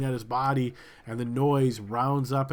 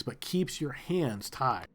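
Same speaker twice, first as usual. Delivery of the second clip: ordinary talk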